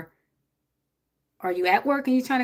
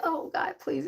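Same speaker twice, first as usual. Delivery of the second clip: higher, softer meek plea; mock polite prayer; 7 semitones higher, 5 dB quieter than usual. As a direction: beg God sweetly, as she says she never does. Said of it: "it don't be like".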